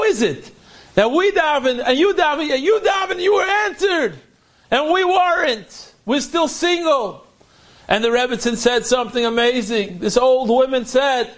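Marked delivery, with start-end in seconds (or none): 0.0-7.3 pleading, rising up in disbelief; emphatic high plea. Retold or imitated. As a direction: voice jumps high on you daven. Girls plead why they weren't answered.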